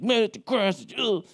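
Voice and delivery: mock fed-up, trailing into ugh; lightly voiced disgust at the lawn; fed-up groan. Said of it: "And I'm just like".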